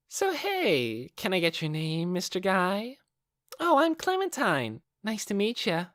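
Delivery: put-on high, teasing young woman's voice; bright, mocking sing-song delight; a high, teasing, toying voice; playful Clementine. Clementine introduces herself.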